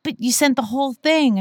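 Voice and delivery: high, strained disbelief; retold panicked boss. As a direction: act out the boss's horror. Boss realizes the whole sheet leaked.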